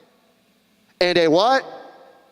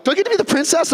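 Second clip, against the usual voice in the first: much higher, eager mock voice; hopeful actor; 11 semitones higher, faster than usual. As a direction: ask like a hopeful kid. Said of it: "and you're like".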